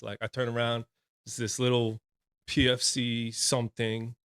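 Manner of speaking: ordinary talk, nothing marked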